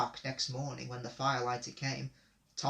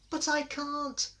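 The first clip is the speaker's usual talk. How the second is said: very high, slow, pleading little voice; small engine protesting; 12 semitones higher, slower than usual. A narrator's reading. Percy refusing Gordon's plan, high and pleading. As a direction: squeak the protest out slowly.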